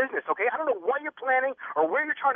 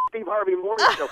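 grin through the show name, laughing; laughing, giving the host his answer; 5 semitones higher than usual. Real, not acted.

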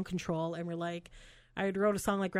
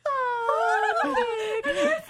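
high, loud, mock swooning aww; swooning fans; 5 dB louder than usual. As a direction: melt like a smitten fan. Fans swooning over Nick.

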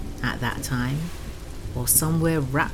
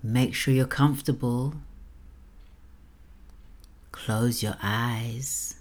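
sultry narrator. Acted. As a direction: lull the listener toward sleep. Sleep story host voice.

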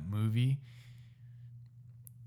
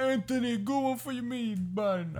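very high, hammed-up dad voice; squawked-up mock dad cheer; TikTok dad impression; 12 semitones higher, 4 dB quieter than usual. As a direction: imitate a dad calling to his kid at baseball. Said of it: "About the Italian dad".

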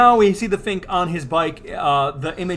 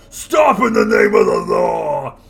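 loud, slow command to halt; lawman shout; 5 semitones higher, 7 dB louder, slower than usual. Acted. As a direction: bellow it like a cop. Judges burst in.

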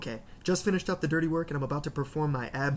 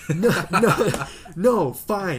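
hurried loud reassurance; 3 semitones higher, 10 dB louder, much slower than usual. Acted. Man in stall refusing help, loudly insistent. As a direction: fend them off loudly, acting it up.

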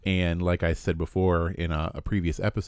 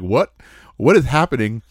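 loud, raised baffled outburst; mock astonishment; 6 semitones higher, 9 dB louder than usual. Other players hearing the voice, mock astonishment. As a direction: play the baffled onlookers, loud and higher. Acting out shocked fellow players.

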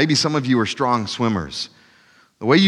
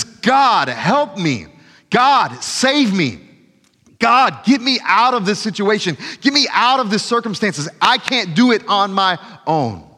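ugly desperate cry; 6 semitones higher than usual. Acted. Preacher acts a desperate prayer from trouble, anguished high plea. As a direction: beg God for rescue, voice straining high. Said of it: "This is an ugly cry to God".

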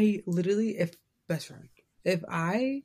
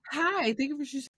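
high-pitched mock customer-service greeting; barista greeting; 9 semitones higher than usual. Barista cut off by an early hello, high mock greeting. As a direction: pitch it way up, imitating the work script. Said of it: "literally am like".

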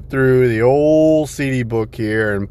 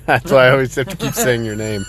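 normal talking voice

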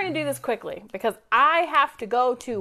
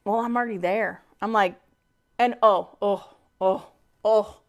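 ordinary talk, nothing marked

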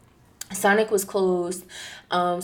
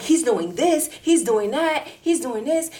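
"this", "that", "this" each hit at a higher pitch; running down a list; 7 semitones higher than usual. Retold or imitated. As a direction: rattle off grievances as a list, rising on "this" and "that". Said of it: "started running down the list".

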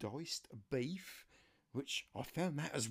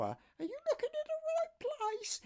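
much higher falsetto voice for his niece; niece's voice. Retold or imitated. Niece phoning her uncle for help, high falsetto. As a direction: play the niece asking a favour, pitched way up. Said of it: "this is my niece Laura".

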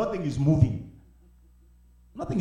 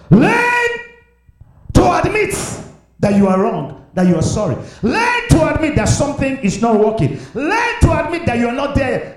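high, loud urging from the pulpit; preaching exhortation; 5 semitones higher, 6 dB louder than usual. Real, not acted. Pastor urging the church to admit wrongs, loud preaching. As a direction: drive the command home.

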